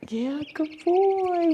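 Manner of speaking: pet praise. Real, not acted. Praising the owl.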